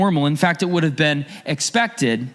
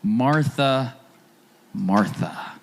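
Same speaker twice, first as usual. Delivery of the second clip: a soft, lower, tender calling of her name; gentle, compassionate; 5 semitones lower, 3 dB quieter, much slower than usual. Retold or imitated. Jesus gently answering anxious Martha, compassionate and low. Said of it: "not in a patronizing way".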